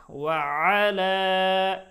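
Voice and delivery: ওয়া আলা with the final aa held long and loud; three-alif stretch. Shown as a demonstration. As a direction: draw out the last vowel of ওয়া আলা on a steady note. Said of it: "এখানে তিন আলিফ হবে". (ওয়া আলা-র শেষে তিন আলিফ টান)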